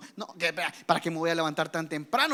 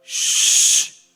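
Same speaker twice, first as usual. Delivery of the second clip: long loud hissed shh; loud shush; 12 dB louder than usual. Shown as a demonstration. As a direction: shush the whole room loudly. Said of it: "Así conmigo".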